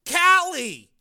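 high, loud, insistent cry; shouted name. A narrator's reading. Frank answering his brother, insistent shout. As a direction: shout the name to get through. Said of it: "Frank shouted the name".

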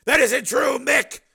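very high, loud call; shouting out. A narrator's reading. Steel calling to Stalker, very high and loud. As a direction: shout across the concourse. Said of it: "Steele called out".

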